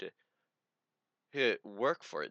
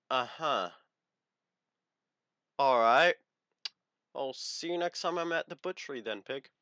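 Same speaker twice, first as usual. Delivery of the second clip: deadpan comeback; 3 semitones higher than usual. Retold or imitated. Retelling his cold comeback to the girl. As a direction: deliver the insult coolly. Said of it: "I'm, like, going".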